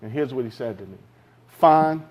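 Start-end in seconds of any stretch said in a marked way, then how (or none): 1.5-2.0 clipped, annoyed "Fine" a bit louder; retold irritated official cutting the call short; frustrated curt reply. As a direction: snap the one word and hang up. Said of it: "He was so frustrated that I said that".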